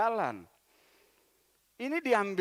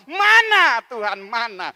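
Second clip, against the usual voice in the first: loud complaint; 3 semitones higher, 14 dB louder than usual. Acted. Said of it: "kadang ketika tidak dijawab".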